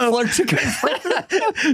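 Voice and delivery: laughing repeat. Real, not acted. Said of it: "repeats friend's line laughing".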